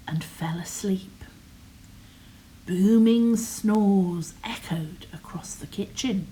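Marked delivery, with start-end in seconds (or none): none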